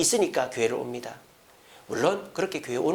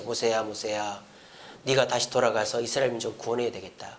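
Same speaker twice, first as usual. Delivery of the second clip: God gently summoning Moses, lower and softer; 6 semitones lower, 5 dB quieter than usual. Acted. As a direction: call Moses from the burning bush, lower and hushed. God calls Moses from bush.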